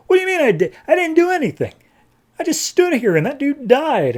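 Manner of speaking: very high, incredulous squeal; mock protest. Stranger denying to God he did anything, mock incredulous.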